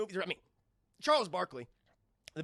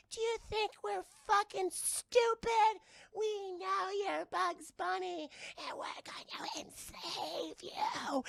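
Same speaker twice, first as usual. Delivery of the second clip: high, slow alien threat; menacing alien voice; 13 semitones higher, slower than usual. Retold or imitated. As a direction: sneer it like a gloating cartoon alien. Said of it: "and you're like".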